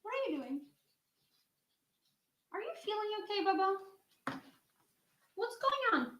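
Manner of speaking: high, soft coaxing voice to a frightened dog; worried pet talk. Real, not acted. Owner asks her shaking dog what's wrong, high anxious coo.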